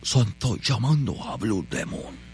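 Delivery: low, heavy luchador voice; strong wrestler voice. Pretend. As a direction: boom it out like a tough wrestler. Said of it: "una voz de luchador fuerte".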